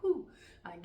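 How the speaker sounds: loud mocking whoo; a loud, falling hoot from the crowd; kids hooting. Classmates hooting at the talking boy, loud. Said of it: "you can hear them in the background like".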